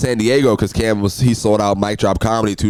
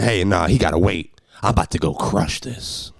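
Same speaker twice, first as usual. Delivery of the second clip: lower, swaggering brush-off voice; cocky future self; 4 semitones lower, 4 dB quieter than usual. Retold or imitated. Imagined famous self brushing off T I, lower cocky swagger. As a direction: play the big star waving off T I, cool and low. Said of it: "I don't know why I said it like that".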